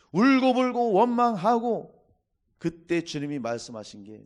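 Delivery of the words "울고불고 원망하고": preaching climax. Real, not acted.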